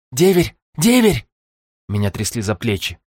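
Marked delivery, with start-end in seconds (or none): 0.1-0.6 higher, louder rising call; urgent call. Acted. Shaking him awake. 0.8-1.3 much higher falling shout; desperate shout. Acted. Shaking him awake.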